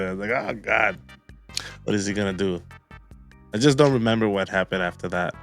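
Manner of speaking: normal talking voice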